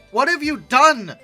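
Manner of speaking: normal talking voice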